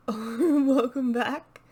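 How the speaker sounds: ordinary talk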